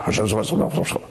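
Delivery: fast, mumbled by rote; rattled off. Shown as a demonstration. Praying by rote, rattling the words off fast. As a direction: rush it like paying a bill. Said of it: "You're not paying a bill".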